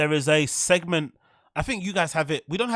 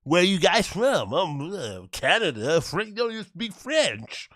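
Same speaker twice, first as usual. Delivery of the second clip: mock comedian crowd work; 3 semitones higher, slower than usual. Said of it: "fuck off".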